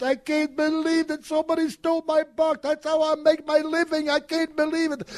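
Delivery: high, panicked wail over the stolen shoebox; freaking out. Retold or imitated. Shoeshine man panicking to the office about his stolen box, high frantic wail. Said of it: "he is actually freaking out".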